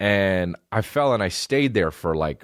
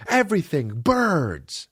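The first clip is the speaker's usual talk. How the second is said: put-on scared kid; 10 semitones higher, slower than usual. Comedian as a child telling dad, higher and frightened. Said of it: "and I literally said".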